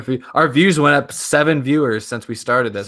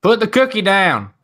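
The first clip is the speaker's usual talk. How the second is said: higher, slow, drawn-out impression of a racing driver; Dale Junior voice; 7 semitones higher, 3 dB louder, slower than usual. Reading a viewer's request on the podcast, as a slow higher racer impression. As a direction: stretch the line out like a folksy racer. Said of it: "in a Dell Junior voice".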